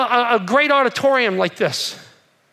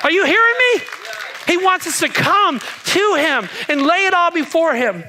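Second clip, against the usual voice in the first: rousing shout; 6 semitones higher than usual. Real, not acted. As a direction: shout it out to the whole room. Preacher rousing the congregation.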